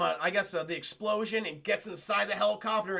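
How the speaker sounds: ordinary talk, nothing marked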